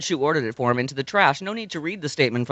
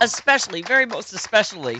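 mock emphatic; 6 semitones higher, 4 dB louder than usual. Mocking emphasis, louder higher.

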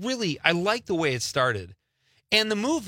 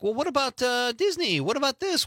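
much higher-pitched, coaxing parent voice; coaxing dad; 10 semitones higher than usual. Retold or imitated. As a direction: re-enact a dad pitching alternatives to his kid. Dad coaxing son toward other shows.

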